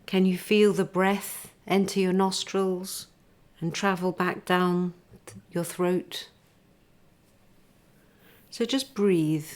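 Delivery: unhurried meditation teacher; guided body scan. Acted. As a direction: lead them gently into stillness, breath by breath.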